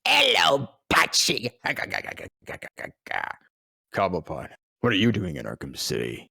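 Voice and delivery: a hint of a sneering Penguin. Nolan doing Penguin for the young actor, a light sneer. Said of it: "he goes".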